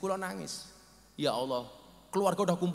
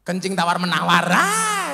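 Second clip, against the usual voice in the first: loud punchline; 9 dB louder than usual. Real, not acted. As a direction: holler the joke out. Joking punchline shout. (guyonan seru)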